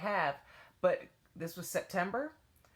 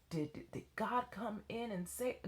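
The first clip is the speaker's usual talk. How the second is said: hushed inner voice, unvoiced; whispered thought; 3 semitones higher, 7 dB quieter than usual. Teacher thinking to herself in a hushed whisper. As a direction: whisper the doubt to yourself.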